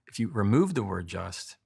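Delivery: ordinary delivery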